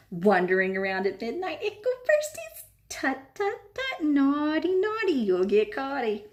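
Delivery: high sing-song teasing voice; tut-tut and taunt, sing-song; mischievous poltergeist. A narrator's reading.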